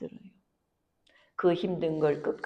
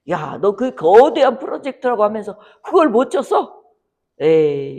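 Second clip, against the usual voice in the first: mocking jeer; 3 semitones higher, 8 dB louder than usual. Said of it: "이렇게 비웃을 거라는 거예요".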